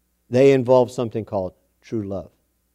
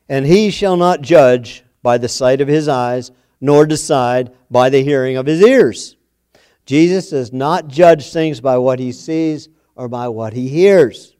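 louder, slow, solemn Bible reading; scripture reading; 7 dB louder than usual. Real, not acted. Preacher reading Isaiah to the congregation, slow and loud. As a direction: read it out to the whole room.

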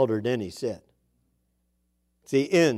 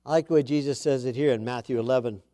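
usual speaking voice

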